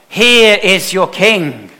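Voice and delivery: a governor unveiling a prisoner to the crowd; slow grand presentation. Retold or imitated. To the crowd, Pilate presents Jesus slowly and loudly. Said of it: "he says".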